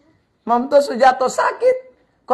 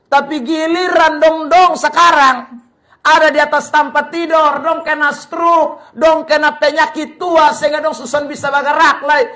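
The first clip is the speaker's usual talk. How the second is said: slow, raised preaching voice; 4 semitones higher, 4 dB louder than usual. Acted. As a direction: preach it slowly, lifting the pitch. Preaching about neglected parents. (berkhotbah dengan suara tinggi dan lambat)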